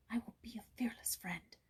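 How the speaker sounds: soft whispered resolve; girl steeling herself, whispering; whispered thought. A narrator's reading. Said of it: "she thought".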